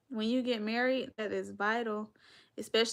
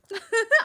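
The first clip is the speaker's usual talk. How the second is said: loud laugh; 13 semitones higher, 12 dB louder than usual. Real, not acted. Laughing at her own age.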